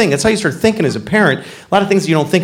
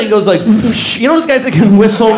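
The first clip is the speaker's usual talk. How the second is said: normal talking voice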